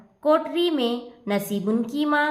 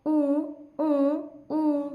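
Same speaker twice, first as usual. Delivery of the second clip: muffled wailing "oo oo oo" from inside a pot; boy whimpers, muffled by the pot; muffled boy's wail; 3 semitones higher, much slower than usual. Acted. Boy stuck in a pot wails, muffled and whimpering. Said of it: "बस रोए चला जाता है".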